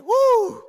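high, loud "woo"; exhilarated whoop. Retold or imitated. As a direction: imitate Dad whooping with delight. Dad climbs back, exhilarated.

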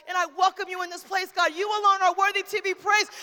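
loud, high preacher's prayer building to long cries; ecstatic, drawn-out declarations of praise; shouted praise. Real, not acted. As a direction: proclaim worship to God at full voice.

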